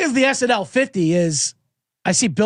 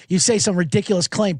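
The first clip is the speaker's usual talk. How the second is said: ordinary talk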